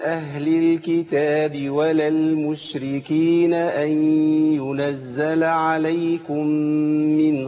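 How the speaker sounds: تلاوة بطيئة ممدودة بنغم; رتّل الآية على مهل كالمعلم; تلاوة مجودة. Shown as a demonstration. Teacher recites. (تلاوة مرتلة للطلاب)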